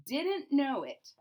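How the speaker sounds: ordinary delivery, nothing marked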